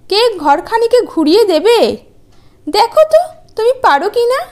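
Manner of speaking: high, sneering and teasing; mocking wife. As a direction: talk down to him with scorn. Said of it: "নাক সিটকিয়ে কুকরোর পানে তাকিয়ে".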